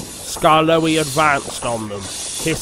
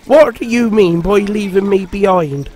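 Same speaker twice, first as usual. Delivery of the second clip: higher, louder stern demand; 5 semitones higher, 6 dB louder than usual. A narrator's reading. Lady scolding Peter Sam for leaving her. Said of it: "she demanded".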